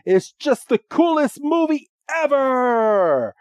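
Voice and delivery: high squealing mock outrage and hype; play-acted overexcited fan explaining; fanboy gushing. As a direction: gush over it, stretching the big words. Voicing fans shocked Ash doesn't know movie.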